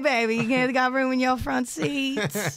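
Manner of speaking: loud, slow, drawn-out come-on; tipsy flirt. Acted. Acts tipsy flirting with Uber driver.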